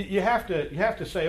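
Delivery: ordinary delivery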